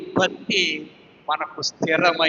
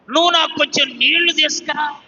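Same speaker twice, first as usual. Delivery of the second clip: a hint of a commanding prophet, higher and louder; lightly voiced prophet calling out for water; Elijah asking; 8 semitones higher, 4 dB louder than usual. Elijah asks the widow for water, lightly voiced call.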